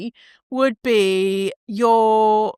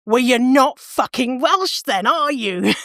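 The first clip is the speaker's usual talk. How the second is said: drawn-out, higher mock-indignant retort; acted indignation; 4 semitones higher, slower than usual. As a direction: challenge them with pointed disbelief, then laugh. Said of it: "that would piss me off so much".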